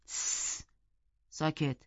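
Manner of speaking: a sharp hiss, then a soft hush; shushing interruption. A narrator's reading. Narrator cuts Heathcliff off mid-story, shushing quietly. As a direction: shush softly to cut in. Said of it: "حرفش رو قطع کردم".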